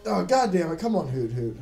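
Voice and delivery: usual speaking voice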